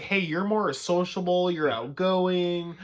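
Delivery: lightly hammed-up sales pitch; mock recruiter. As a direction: drawl out the flattery.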